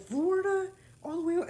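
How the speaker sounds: high, loud exaggerated surprise; mock surprise. To the cashier, faking big interest: high surprised voice. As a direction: act wildly impressed by her answer.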